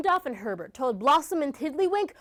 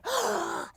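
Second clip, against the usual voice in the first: sharp gasp; 7 semitones higher, 6 dB quieter than usual. Acted. Crowd gasps at reveal.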